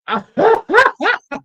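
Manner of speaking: short high burst of laughs; amused cackle; real laughter. Real, not acted. Amused by the accent idea.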